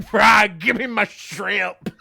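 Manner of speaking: slow, loud mock-threatening demand; menacing demand. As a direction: drag out the demand like a movie psycho. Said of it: "it's like".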